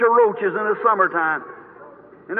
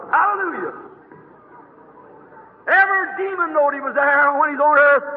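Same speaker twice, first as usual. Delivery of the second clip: ordinary delivery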